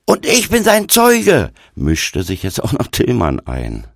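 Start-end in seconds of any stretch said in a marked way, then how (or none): 0.1-1.5 high, loud backing-up shout; shouted interjection. A narrator's reading. Tillmann butting in as his friend's witness, shouting. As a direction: butt in at full voice.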